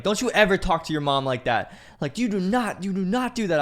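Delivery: high, loud mock scolding; retold angry scream. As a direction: imitate a mom yelling at her kid. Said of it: "She screams at this fucking kid".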